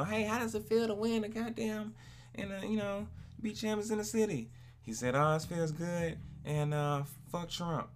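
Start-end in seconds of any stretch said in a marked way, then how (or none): 0.0-4.5 high, lightly mocking reporter; mock interviewer voice. Mimics interviewer's question. 5.2-7.9 high, casual mock fan voice; imitating a fan.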